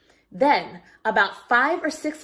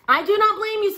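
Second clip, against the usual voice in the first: much higher, louder bear voice; Orange Bear; 10 semitones higher, 4 dB louder, faster than usual. A narrator's reading. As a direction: speak up brightly as the toy bear. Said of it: "said Orange Bear".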